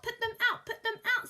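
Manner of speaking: high, loud demand; insistent fish. Acted. Fish demanding the children put the Things out, high loud. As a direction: order them out.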